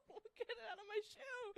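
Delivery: high, half-crying panicked plea; hysterical plea. Retold or imitated. Begging Kenzie to get the spider out, hysterical. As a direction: beg through laughing tears to get it out.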